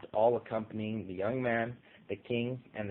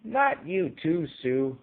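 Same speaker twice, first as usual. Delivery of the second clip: a touch of an exasperated yell; exasperated man; 6 semitones higher, slower than usual. A narrator's reading. Man fed up with Sue, mild exasperated yell. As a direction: groan it at her. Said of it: "a man yelled out".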